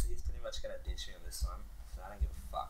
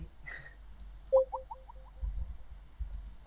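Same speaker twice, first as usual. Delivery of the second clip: high-pitched squealing laugh; real high giggle fit; high breathy giggle; 4 dB quieter than usual. Real, not acted. Laughing at the friend leaving.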